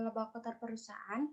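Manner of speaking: normal talking voice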